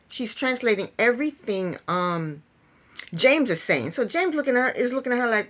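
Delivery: ordinary talk, nothing marked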